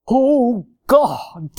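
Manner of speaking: affected, drawn-out pulpit prayer; mock a pompous praying voice; stretched, put-on holy voice. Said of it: "some people have prayer voices".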